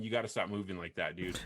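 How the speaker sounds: ordinary talk, nothing marked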